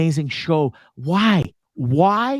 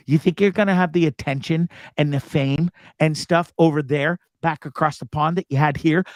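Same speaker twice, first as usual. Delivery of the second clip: usual speaking voice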